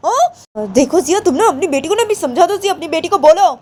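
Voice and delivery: high, loud accusing tirade; a grandma berating her son over the child; angry scolding. Acted. Grandmother blames Jiya loudly.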